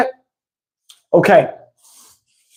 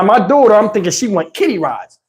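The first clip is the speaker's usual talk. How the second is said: usual speaking voice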